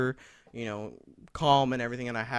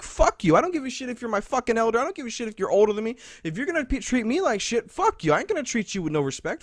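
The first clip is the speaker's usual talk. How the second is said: heated, high-pitched retold rant; getting angry; 6 semitones higher than usual. Student refusing to respect his elder, angry outburst. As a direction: relive the blow-up, pitch climbing, words tumbling out. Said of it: "I'm actually getting angry talking about it".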